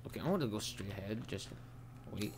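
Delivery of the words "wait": normal talking voice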